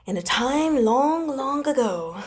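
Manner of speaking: high, slow once-upon-a-time voice; storybook opening. Pretend. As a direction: spin it like a fairy tale for kids. Playful fairy-tale opening.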